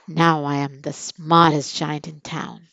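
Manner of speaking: puffed-up pride in his new clothes; proud. A narrator's reading. Giant boasting about his smart new clothes, proudly. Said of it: "he said proudly".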